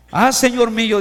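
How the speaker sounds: Aaron begging Moses, loud plea. Acted. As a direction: cry it out loud and high. Rereading Aaron's plea with force.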